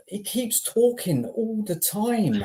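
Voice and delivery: high, slow, drawn-out complaint; exasperated. Real, not acted. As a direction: stretch it out in disbelief. Frustrated rant about manager.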